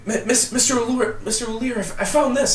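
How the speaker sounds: put-on excited young villager calling for help; breathless young man. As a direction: blurt it out, tripping over the name. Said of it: "this one young guy kinda comes running up".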